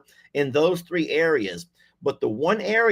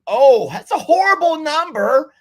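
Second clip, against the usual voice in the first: high, loud dismay at a bad result; host reacting to the quiz result with a high, loud groan; dismayed surprise; 10 semitones higher, 7 dB louder than usual. Real, not acted. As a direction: gasp at a horrible number.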